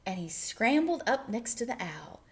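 ordinary delivery, nothing marked

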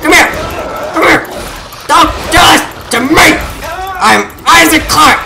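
high, punched-out battle cries; combative yelling. Real, not acted. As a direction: yell threats at the monster. Fighting monsters, shouting threats.

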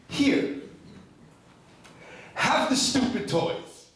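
high, slow, emphatic giving-up; exasperated surrender. Acted. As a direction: hand it over in pleading exasperation. Handing back toys in exasperated plea.

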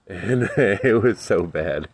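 laughing through the words. Real, not acted. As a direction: say it while still laughing. Laughing while recalling bad pepper.

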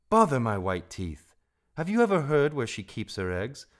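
loud, irritated brush-off; cross, voice rising as it asks; impatient. A narrator's reading. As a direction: cut the praise short and press the question. Rikki brushes off praise impatiently.

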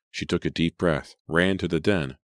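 usual speaking voice

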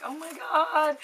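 high, thrilled oh my God; excited gasp. Retold or imitated. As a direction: squeal with delight at the package. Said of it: "I was like".

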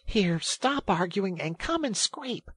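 high, drawn-out urging; exasperated order. A narrator's reading. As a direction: chivvy a friend into helping. Exasperated rat orders mole to work.

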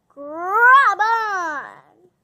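loud call to grab on; shout. Acted. Pine yells to Boof while swinging on vines. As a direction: yell it as he swings.

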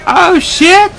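high, loud panicked swearing; real startled shriek; alarmed yell. Real, not acted. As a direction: cry out as it all goes wrong. Alarm as game goes wrong.

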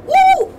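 high, loud yelp; startled whoop. Real, not acted. Player on a runaway ride, whooping in alarm. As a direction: shrieks as it speeds off. Sudden fast ride in game.

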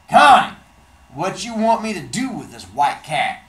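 big guy calling to Connie across the car; called across. Acted. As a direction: call out to her over the car. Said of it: "the guy called across the car".

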